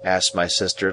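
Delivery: plain reading voice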